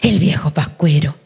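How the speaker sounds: put-on hushed whisper of a child's reply; unvoiced child's whisper; whispered child answer. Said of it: "child's answer".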